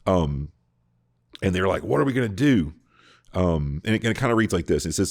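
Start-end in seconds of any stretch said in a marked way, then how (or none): 1.8-2.7 panicked question. Retold or imitated. Panicked council of priests.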